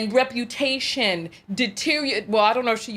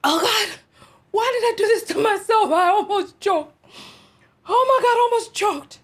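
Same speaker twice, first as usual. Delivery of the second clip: high, loud, strained from laughing; real laughing fit, barely catching breath; choking laughter; 8 semitones higher, 3 dB louder than usual. Real, not acted. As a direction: gasp it out after nearly choking.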